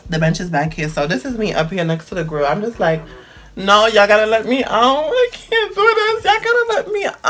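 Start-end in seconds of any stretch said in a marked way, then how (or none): 3.6-7.2 pitched-up pleading whine; mock desperate whimper; high panicked begging. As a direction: beg to be let out, voice way up. Begging to be released.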